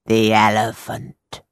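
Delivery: drawn-out mysterious fortune-teller. A narrator's reading. Fortune-teller voiced in story.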